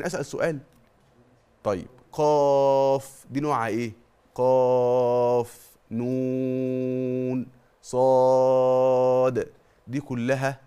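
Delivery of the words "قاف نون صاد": قاف نون صاد each drawn out very long; elongated letter names. Shown as a demonstration. Teacher recites the disjointed letters. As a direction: chant each letter with a six-beat madd. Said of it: "مد لازم حرفي مخفف".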